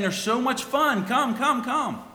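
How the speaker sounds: high, coaxing beckoning voice; enticing world. Acted. The tempting world calling sinners to come, high coaxing beckon. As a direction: lure them in, sing-song and sweet.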